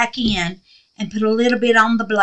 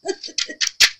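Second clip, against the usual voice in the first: giggle; 4 dB quieter than usual. Real, not acted. Laughing with excitement.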